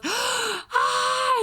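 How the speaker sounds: high mock yell; a hammed-up startled yell; retold yell. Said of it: "she yells and laughs".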